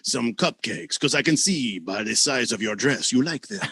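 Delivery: low sultry crooning gone wrong; sexy Larry voice. Acted. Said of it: "here's the thing about Larry".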